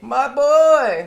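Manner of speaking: high, loud, hammed-up wail; mock anguished cry. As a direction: cry out over a fallen comrade, played for laughs. Soldier cries over dying friend.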